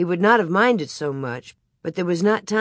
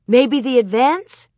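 slow, higher, louder question; hopeful guess; 6 semitones higher, 6 dB louder than usual. A narrator's reading. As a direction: venture it with growing hope. Mary guesses at the surprise.

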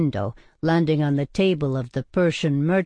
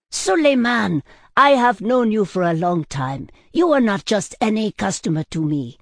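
higher, insistent plea to a friend; earnest appeal; 5 semitones higher, 4 dB louder than usual. A narrator's reading. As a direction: implore your old friend to listen. Worried friend earnestly appeals to him.

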